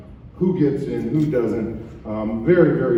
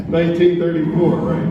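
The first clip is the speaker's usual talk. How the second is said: laughing; 5 semitones higher, 7 dB louder than usual. Real, not acted. Joking about colleague's age.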